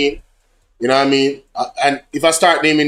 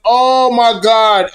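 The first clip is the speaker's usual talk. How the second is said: surprised; 5 semitones higher, much slower than usual. Real, not acted. Bot times out a viewer.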